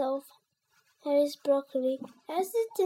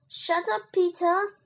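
mildly cross, raised-pitch retort; irritated sibling retort; 5 semitones higher, 4 dB louder than usual. A narrator's reading. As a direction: shut your brother up. Henry snaps at brother.

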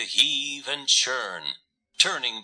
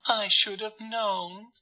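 shy cooing; 10 semitones higher than usual. A narrator's reading. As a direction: coo it shyly like a little dove. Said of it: "cooed Dove shyly".

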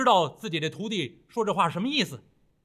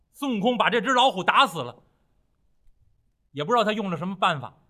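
ordinary delivery

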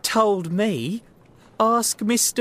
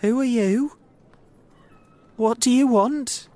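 high, anxious questions; weak questioning; 10 semitones higher, 6 dB louder than usual. Acted. Startled little man questioning the strangers who stopped him, weakly. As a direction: ask nervously, voice pitched well up. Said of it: "he asked weakly".

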